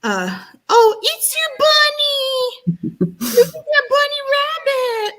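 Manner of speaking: very high squeaky bird voice, slowed; imitating the bird. Cockatoo voiced as it spots the bunny, high squeaky. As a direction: play the cockatoo, excited. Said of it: "he's like".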